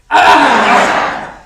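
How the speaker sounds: loud, fierce open-mouthed Ah; clawing roar. Shown as a demonstration. Group exercise, teacher leads everyone clawing with a loud roaring ah. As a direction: claw the air with a big roaring Ah. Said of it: "let's claw it with the sound".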